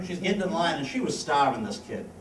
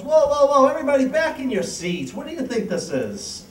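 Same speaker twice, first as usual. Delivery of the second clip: nun shouting order; 9 semitones higher, 4 dB louder than usual. Acted. Nun breaks up stampede.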